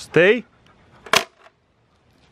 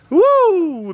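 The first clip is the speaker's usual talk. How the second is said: high, loud whoop; long, high, loud woo; excited whoop; 11 semitones higher, 5 dB louder than usual. Real, not acted. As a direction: cheer the dogs' speed with a whoop. Cheering the fast dogs.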